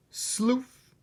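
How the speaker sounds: high, swooping "Sloof!"; playful sound effect. Acted. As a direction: roll the bag out with a whoosh. Sleeping bag sound.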